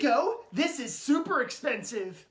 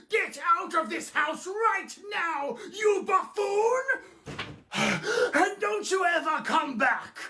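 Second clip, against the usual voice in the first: mock furious yell; 5 semitones higher than usual. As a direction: chase the pest out, shouting. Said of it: "why are you out of breath".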